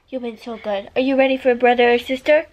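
normal talking voice

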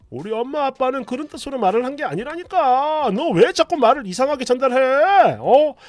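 a husband yelling at his crying wife; barking husband. Retold or imitated. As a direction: shout her down for crying. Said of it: "여전히 짖고 있습니다".

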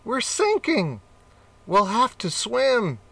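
very high alarmed cry; frantic as the boat goes down; panicked cat. Acted.

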